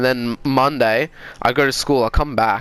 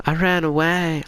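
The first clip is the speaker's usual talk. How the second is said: put-on higher, timid child's confession; imitated scared kid; 4 semitones higher than usual. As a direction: own up like a frightened kid, lightly acted. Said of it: "I'm fucking scared".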